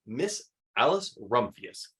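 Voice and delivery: usual speaking voice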